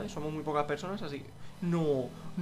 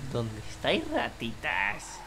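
mock villain taunt; 4 semitones lower, slower than usual. Taunting rival players as villain.

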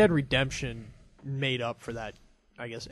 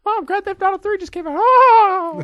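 rushed high falsetto ending in a loud squeal; hammed-up excited teen, very high; Mickey Mouse; 12 dB louder than usual. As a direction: beg mom in a squeaky cartoon voice. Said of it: "I sounded like Mickey Mouse".

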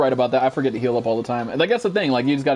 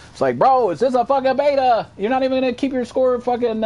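louder and higher, fed-up rant about quitters; annoyed rant; 11 semitones higher, 4 dB louder than usual. Real, not acted. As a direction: scold the rage quitters, voice up high. Ranting about rage quitters.

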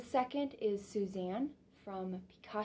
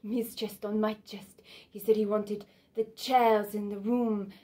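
Suzanne recounting desire; 8 dB louder than usual. Acted. Suzanne lists what Picasso said he wanted, growing more intense. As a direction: let each item press a little harder.

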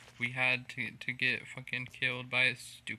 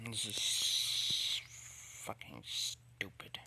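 whispered; 2 semitones lower, much slower than usual. Real, not acted. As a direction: breathe it out in disgust. Muttering alone after death.

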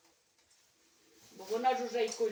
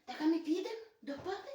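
normal talking voice

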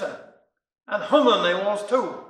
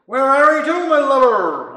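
loud, higher Cornish call; 4 semitones higher, 9 dB louder than usual. A narrator's reading. Husband and stranger calling to each other in the night. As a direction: shout across the dark street.

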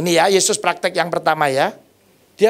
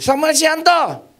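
mock nurse calling a name, higher and slow; 9 semitones higher, slower than usual. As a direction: call the name down the corridor. Nurse calls out his name. (suster memanggil nama keras dan pelan)

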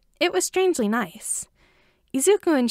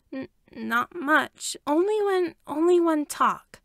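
timid; 6 semitones higher, slower than usual. A narrator's reading. Scared boy answers hesitantly.

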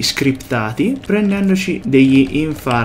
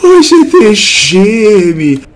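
exasperated; 7 semitones higher, 7 dB louder, much slower than usual. Real, not acted. Exasperated at game ghosts.

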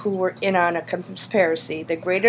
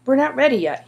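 higher-pitched, worried objection; protesting; 6 semitones higher, faster than usual. A narrator's reading. Boy objects to friend's hasty plan, protesting. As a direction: push back against the plan. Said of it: "he protested".

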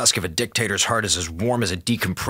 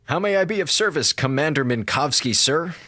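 mock servility; 7 semitones higher than usual. Mock servile greeting to commander.

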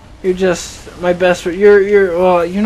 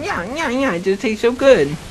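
mock munching creature; 5 semitones higher than usual. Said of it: "eating a cracker or something".

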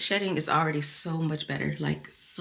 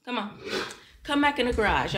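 ordinary talk

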